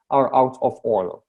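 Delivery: usual speaking voice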